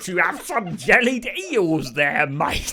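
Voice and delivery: loud, high, drawn-out Cockney caricature; hammed-up stage Cockney; mock Cockney accent. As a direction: belt out a terrible, exaggerated Cockney. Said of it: "In that language would be like".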